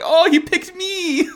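very high, drawn-out gushing squeal; delighted mock surprise. Pupil thrilled to be picked by master, squealing in surprise. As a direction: gush like a star-struck pupil, slowly. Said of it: "he's surprised every single time".

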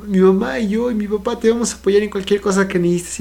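put-on high-pitched kid sister, earnest and fussing; worried little sister. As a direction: sound like a small sister anxiously offering support. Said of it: "su hermana dice".

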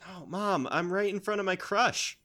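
put-on high, mortified teenage whine; whiny teen son. Son embarrassed before his crush.

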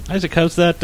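ordinary delivery

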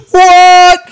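high, loud incredulous what; baffled what. Retold or imitated. Hearing her roar, a high loud baffled what. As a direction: squawk it in disbelief. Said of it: "We're just like".

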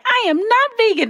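high, insistent mom imitation; mom protesting. Mom to daughter, high insistent protest. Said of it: "She was like".

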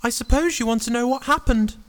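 high falsetto hero; Jack character. Acted. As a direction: speak in a small, very high put-on voice. Jack addresses his mother.

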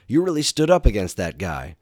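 put-on bright elderly mentor voice; Doctor Light voice.

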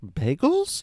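"Bagels?" with a sharply rising question tune. Retold or imitated. Retelling his one-word answer to co-host, questioning tone. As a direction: turn "Bagels?" into a question, pitch swooping up at the end. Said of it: "says it in that questioning tone".

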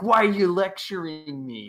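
overreacting teenager, imitated; retold teenage indignation. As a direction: whine it like a lectured teenager. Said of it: "And Peter's like".

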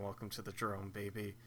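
low, soft imitation; quiet flat repeat. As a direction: murmur the catchphrase back. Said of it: "[name] saying".

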